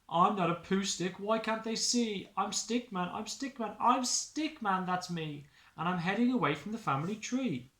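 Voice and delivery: high, insistent protest; frustrated Stickman. Acted.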